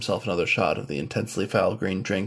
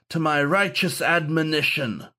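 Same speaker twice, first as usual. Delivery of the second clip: sneering; 7 semitones higher than usual. A narrator's reading. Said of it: "sneered the wolfhound".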